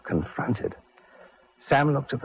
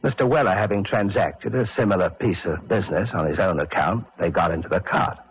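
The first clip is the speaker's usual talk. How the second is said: usual speaking voice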